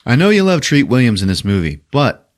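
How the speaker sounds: ordinary talk, nothing marked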